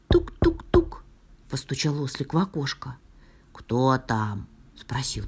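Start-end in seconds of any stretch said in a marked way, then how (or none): 0.1-1.0 very high, crisp knocking imitation; light high-pitched knock sound in words. A narrator's reading. 3.5-4.5 low bear voice; low-pitched storybook "who's there"; bear at the door. A narrator's reading. Bear answers knock in low voice.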